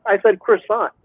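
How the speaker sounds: croissant with a clear R, "kris-SAHNT"; Americanized pronunciation. Retold or imitated. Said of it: "And I was like".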